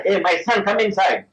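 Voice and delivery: a father calling his son indoors. Retold or imitated. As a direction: call the boy in from outside.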